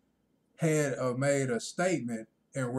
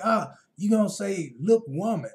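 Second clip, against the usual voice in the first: mock offended woman; 6 semitones higher, 6 dB louder than usual. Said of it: "So she like".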